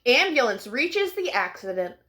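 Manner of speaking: ordinary delivery, nothing marked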